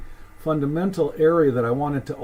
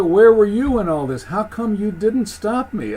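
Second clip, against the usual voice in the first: high, loud indignant protest; aggrieved, raised-voice challenge; Cain accusing God; 4 semitones higher, 5 dB louder than usual. Acted. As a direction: demand to know why nobody stepped in. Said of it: "So Cain is saying".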